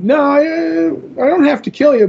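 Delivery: Morpheus voice. Retold or imitated. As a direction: lift the voice high for his calm refusal. Morpheus answers the god's threat.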